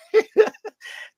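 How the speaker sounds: short high nervous laugh. Real, not acted. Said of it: "That was a nervous laugh".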